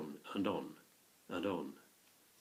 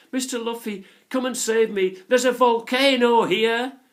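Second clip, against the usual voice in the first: loud, much higher cry for rescue; screaming in relief; 13 semitones higher, 13 dB louder than usual. A narrator's reading. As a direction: scream to him, desperate but relieved. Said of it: "Anne screamed to him in relief".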